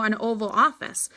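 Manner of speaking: usual speaking voice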